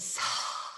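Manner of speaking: long unvoiced exhale; slow release of air as guidance; audible breath out. Shown as a demonstration. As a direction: let the breath go with a soft sigh. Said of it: "breathe out".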